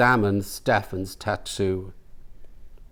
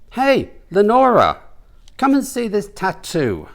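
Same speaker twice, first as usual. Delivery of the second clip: calling out; 10 semitones higher than usual. Acted. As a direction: call a friend over, high. Woman calls.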